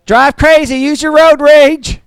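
high, slow, loud comic send-off; mock cheery farewell, hammed up; a put-on breezy goodbye voice; Tim Hawkins impression. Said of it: "Kinda like Tim Hawkins".

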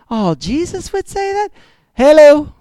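high mock surprise then a pointed hello; mock shocked. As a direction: play a scandalised churchgoer, then snap back. Mock shocked listener, then reply.

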